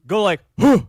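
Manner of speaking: loud exhaled "huh" demonstration; punched-out grunt, imitating a teacher; teacher's huh. Vocal teacher showing the squat exercise, loud forceful huh. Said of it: "she'd just be like".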